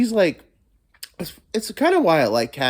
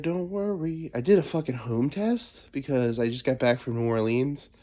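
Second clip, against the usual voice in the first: normal talking voice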